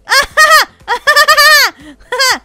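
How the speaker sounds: high, loud burst of laughter; shrieking laugh. Real, not acted. Reacts to being squashed.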